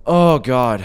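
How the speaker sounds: sudden frightened oh God; startled gasp. Real, not acted. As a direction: jolt of real fright. Startled by horror game.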